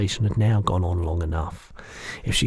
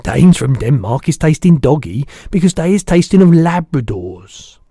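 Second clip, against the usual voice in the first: the giant's voice; 7 semitones higher, 12 dB louder than usual. Acted. Giant correcting the girl, big booming voice.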